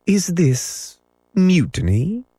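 high, mock-pleasant question; a touch of silky pleasantness. A narrator's reading. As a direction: ask it lightly, pitch lifted. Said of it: "more pleasantly than ever".

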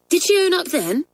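high eager schoolgirl question. Acted.

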